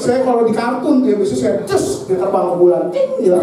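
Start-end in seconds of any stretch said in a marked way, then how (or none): 2.9-3.4 cartoon ting. Cartoon punch sound effect.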